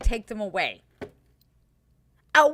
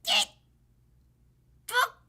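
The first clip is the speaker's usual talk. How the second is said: ordinary talk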